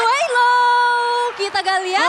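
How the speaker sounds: high, loud yell of the name; excited shout. Real, not acted. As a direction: shout it as the play happens. Caster yells hero name at play.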